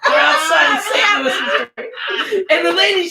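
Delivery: talking through laughter, pitch up; giggling while telling the story; laughing. Real, not acted. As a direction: laugh the words out.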